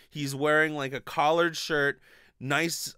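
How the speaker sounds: normal talking voice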